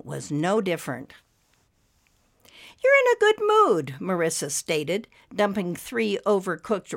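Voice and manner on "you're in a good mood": high, louder, sing-song remark; pointed greeting. A narrator's reading. Wife to husband over breakfast, pointed. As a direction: pitch it high and pointed at him.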